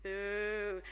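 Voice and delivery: mock boo. Shown as a demonstration. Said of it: "What do we think about them?".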